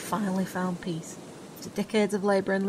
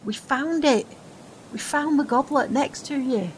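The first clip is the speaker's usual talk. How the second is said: high, loud eager news; excited; 6 semitones higher, 6 dB louder than usual. Acted. Friend eagerly tells him they found the goblet. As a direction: burst out with the good news.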